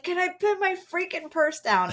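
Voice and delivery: high, exasperated mock snap; retold angry outburst. Mother retelling snapping at her kids, high exasperation. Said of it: "my response was just anger".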